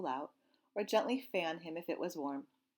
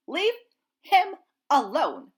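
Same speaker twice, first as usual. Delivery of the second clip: loud, slow, higher furious shout; goose shouting; 10 semitones higher, 14 dB louder, slower than usual. Acted. Old goose screams at the fish to leave the gosling. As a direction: scream it while attacking.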